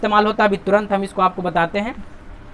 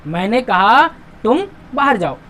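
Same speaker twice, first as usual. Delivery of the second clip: short breaks after कहा and तुम; pause after कहा. Shown as a demonstration. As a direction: lift कहा, brief stop, then तुम. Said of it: "थोड़ा रुकावट आएगा इसमें".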